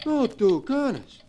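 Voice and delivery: high, anxious protest; frightened pleading. Acted. Joe anxiously swears to Duke he hears nothing, high.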